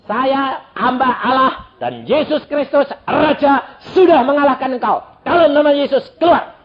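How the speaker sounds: loud commanding rebuke in Jesus' name; exorcising rebuke. Retold or imitated. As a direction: cast the devil out, firm and forceful. Preacher casts out the devil.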